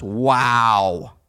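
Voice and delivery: low, loud, drawn-out awe; bowled over by her looks; smitten wow. Retold or imitated. Smitten man reacting to beautiful woman.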